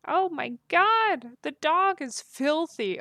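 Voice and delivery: high, loud, slow gasping disgust; drawn-out horrified outcry; disgusted shock. Real, not acted. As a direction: recoil at the filthy dog. Reacting to filthy game dog.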